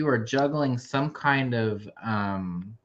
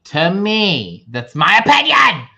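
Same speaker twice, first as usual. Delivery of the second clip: raised, emphatic declaration; loud insistence; 6 semitones higher, 9 dB louder than usual. Real, not acted. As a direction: proclaim it loudly. Insisting on her own view.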